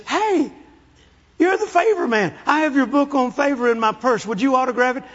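high-pitched lady fan voice; excited flight attendant. Retold or imitated. Flight attendant recognizes the author mid-flight, high and excited. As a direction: pipe up high, asking for an autograph. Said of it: "there's a flight attendant come up".

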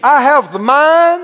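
ringing call-and-response proclamation; out-loud declaration. Shown as a demonstration. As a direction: shout it out for the congregation to repeat. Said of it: "Everybody said out loud".

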